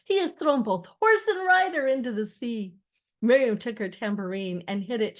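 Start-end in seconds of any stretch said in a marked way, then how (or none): 0.1-2.8 high, loud exultant proclamation; voice carrying far. A narrator's reading. Moses proclaiming victory over horse and rider, carrying cry. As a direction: call it out so it carries on the wind. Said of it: "Moses' voice carried on the wind".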